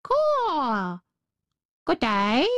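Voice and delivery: cawing crow voice, long drawn vowel. A narrator's reading. Crow greets the little girl, cawing. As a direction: greet like a croaking storybook bird. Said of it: "it nodded its head and said".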